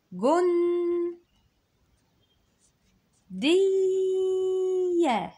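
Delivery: جن and دية high, loud and held; high sing-song syllables. Shown as a demonstration. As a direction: call each syllable up high. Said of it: "الجيم معاها ايه". (تقطيع بصوت عالي ممدود)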